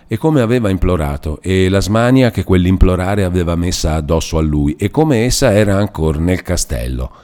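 usual speaking voice

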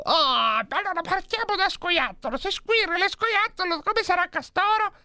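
very high, thin cartoon voice working it out; high chipmunk brain-cell voice; squeaky neuron voice. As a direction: voice the brain cells puzzling it over.

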